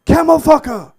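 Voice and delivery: high, loud screaming insult; furious insult. Retold or imitated. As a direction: scream the slur twice at full force. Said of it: "He said".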